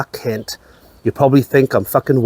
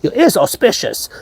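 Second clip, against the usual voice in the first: high-pitched, hammed-up Chinese accent; badly faked Chinese accent, pitch leaping up; mock Chinese accent; 11 semitones higher than usual. Buddhist telling him about his lucky ears, hammed-up Chinese accent. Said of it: "is that even a Chinese accent?".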